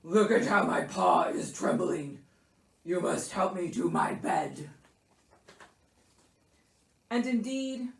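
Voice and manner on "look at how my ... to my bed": self-pitying bear plea; wheezing, ailing Bear. A narrator's reading. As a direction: beg for help as a sick bear. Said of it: "Bear wheezed".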